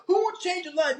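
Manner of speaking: very high shouted rally call; hyped-up speaker shout. Retold or imitated. Seminar speaker building the crowd up, very high shout. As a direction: push the crowd to its peak.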